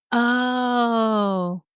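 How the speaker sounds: long drawn-out oh of dawning understanding; sudden realization. Real, not acted. Speaker working out the wax seals, a drawn-out realization. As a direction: stretch it out as it clicks. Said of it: "Light bulb moment".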